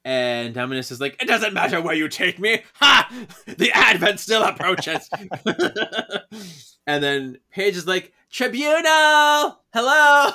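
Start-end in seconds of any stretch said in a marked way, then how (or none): 1.2-5.1 higher, loud theatrical villain voice; hammed-up villain boast; gloating villain. Acting villain Dominus gloating. 8.3-10.3 very high, loud, drawn-out call; hammed-up sing-out call. Paige calling out to Tribunal.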